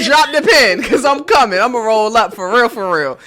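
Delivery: loud hyped swagger, rolling up for real. Retold or imitated. Hyping up friends about rolling up, loud and excited. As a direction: pump it up loud like you're on your way.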